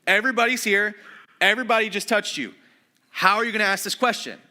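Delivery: a hammed-up exasperated retort; exasperated disciples. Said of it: "The disciples basically go".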